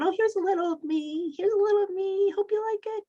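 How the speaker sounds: high, held-up little voice; mock stingy God. Preacher mocking God handing out a little of me, high put-on voice. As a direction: mimic a timid giver in a high sing-song. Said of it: "Not God going".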